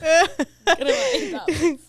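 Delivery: slow words squeezed through a laugh; real laughing. Real, not acted. Gloating to friends about the sale, laughing. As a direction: laugh your way through it, drawn out.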